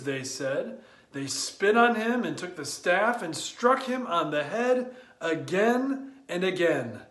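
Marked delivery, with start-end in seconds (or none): none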